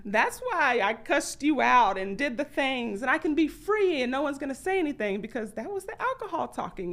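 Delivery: high, loud, defiant self-justifying; brushing off blame on the alcohol; mock belligerent drunk self; defiant excuses. Drunk self voiced making excuses.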